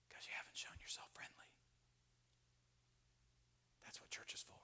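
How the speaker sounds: very soft, voiceless whisper; hushed, intimate pulpit aside; whispered admonition. Real, not acted. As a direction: whisper the answer to the congregation. Preacher whispers his answer.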